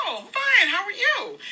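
very high, polite sing-song greeting; pleasant. Retold or imitated. Hurried shopper answering the cashier who stopped her, put-on pleasantness. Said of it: "You know, a pleasant".

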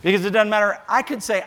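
ordinary talk, nothing marked

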